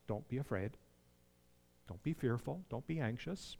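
calm the listener gently; reassuring God. Retold or imitated. Preacher voicing God gently comforting believers, soft. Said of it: "God saying to us".

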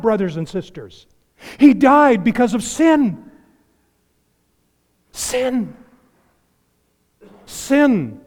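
high, loud fervent proclamation; preaching at full force. Real, not acted. Preacher thundering about sin to the congregation, high and loud.

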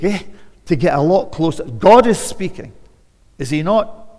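rising, loud preacher's call; fervent sermon build; preaching at full force. Acted. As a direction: press the congregation hard from the pulpit.

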